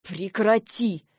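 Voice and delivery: slow, hissed command; hissing. Acted. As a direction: hiss it to keep it down. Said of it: "зашипел он". (прошипеть медленно)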